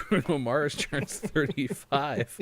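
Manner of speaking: high and loud through laughter; can barely get it out for laughing. Real, not acted. Cracking up with his co-host over a birthday list, laughing.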